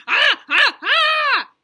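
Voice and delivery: loud high screaming. Acted. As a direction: scream to scare Grandma. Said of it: "I screamed".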